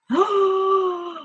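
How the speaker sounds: long, high gasp; mock shocked gasp. Wondering if she's taken for a bot, mock shocked gasp. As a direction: gasp in pretend horror. Pretends shock at being overheard.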